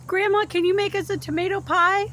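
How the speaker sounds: put-on high, loud pleading kid; child's voice. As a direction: beg like an eager grandchild. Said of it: "I hope my grandkids are like".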